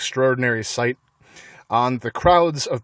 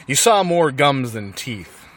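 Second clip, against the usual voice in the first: ordinary delivery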